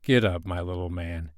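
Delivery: put-on king reassuring the frightened boy; kind voice. A narrator's reading. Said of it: "he said in a kind voice".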